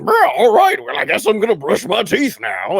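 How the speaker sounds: loud, goofy put-on voice; funny voice. Shown as a demonstration. Podcaster showing the voice used alone at home, goofy funny voice. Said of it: "You just do funny voices".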